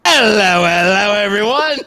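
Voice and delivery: loud, high mock Cockney chimney sweep. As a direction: belt out a hammed-up Cockney greeting.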